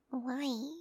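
ordinary talk, nothing marked

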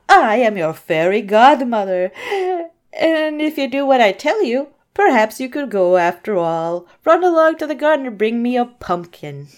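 put-on loud, grand storybook old woman; fairy godmother. Fairy godmother promising Cinderella the ball, loud grand voice. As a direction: declaim it like a witchy godmother. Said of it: "witch, old woman thing said".

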